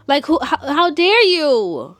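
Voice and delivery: loud, high, drawn-out outrage; indignant outburst. Pretend. Vlogger scolding absent Camille for her nerve, indignant. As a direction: stretch it out, scandalized.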